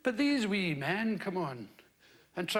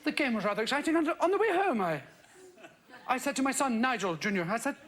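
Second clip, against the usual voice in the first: put-on lightly posh English accent, father and son; a hint of plummy English, a vicar voicing his boy; faster than usual. Radio vicar retells a chat with his son, light posh English accent. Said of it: "Pretty good English accent".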